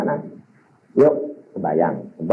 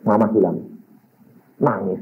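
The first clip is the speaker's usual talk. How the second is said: ordinary talk, nothing marked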